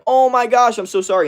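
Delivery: high, loud whiny gushing; mock whiny tone. Prankster consoling a baby who wasn't hurt, mock whiny. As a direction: imitate an over-sympathetic whine. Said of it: "in that, like, whiny tone".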